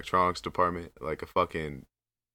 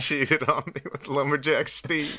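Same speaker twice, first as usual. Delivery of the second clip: higher, slow whiny lament; mock heartbroken gamer; 5 semitones higher, slower than usual. Friends riffing on a heartbroken gamer, mock sad whine. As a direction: play a sad drunk dumped by his wife.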